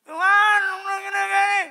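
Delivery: high, very loud mock wail; acted desperate yell. Believer pleading with God, acted desperate yell. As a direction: howl a plea to God. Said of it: "Saudara boleh teriak".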